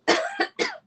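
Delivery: hard choking cough; coughing up water. Real, not acted. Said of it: "That really did go down the wrong way".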